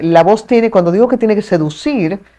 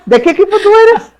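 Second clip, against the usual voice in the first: laughing tease; 5 dB louder, much faster than usual. Real, not acted. Teasing a baseball rival.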